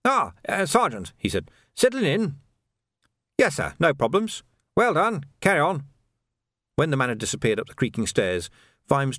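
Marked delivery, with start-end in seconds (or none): 0.0-1.1 nervous. A narrator's reading. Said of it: "looked nervously at Vimes". 1.7-5.8 a higher, hurried nervous captain; a lightly voiced officer trying to sound at ease. A narrator's reading. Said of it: "looked nervously at Vimes".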